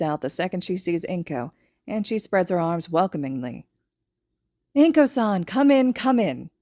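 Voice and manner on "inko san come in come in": higher, louder, slow welcoming greeting; warm welcome. A narrator's reading. Woman warmly welcomes her friend at the door. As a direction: open your arms with the voice.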